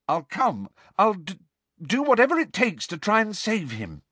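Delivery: high, earnest begging; urgent, high-pitched bargaining; desperate pleading. A narrator's reading. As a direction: plead with him to wait. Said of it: "said Hermione desperately".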